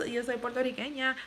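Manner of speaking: yo soy puertorriqueña in native Puerto Rican Spanish sounds; Spanish phrase. Real, not acted. Answering a viewer who asked if she's Puerto Rican, in native Spanish.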